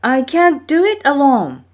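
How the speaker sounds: high, loud, straining as he hauls the line; straining call. A narrator's reading. Maui hauling the fish line, calling out straining. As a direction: heave and call out for help. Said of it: "Maui said, tugging at the line".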